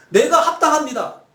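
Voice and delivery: high, loud mock defiance; acted talking back, insisting on being right; defiant retort. Jonah answering God that he is right, defiant retort. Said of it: "이거 아주 도전적인 표현이거든요".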